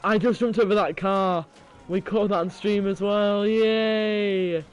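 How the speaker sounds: high, loud excitement; thrilled cheering. Real, not acted. Player celebrates a car jump caught on stream, thrilled. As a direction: crow about the stunt, ending on a long cheer.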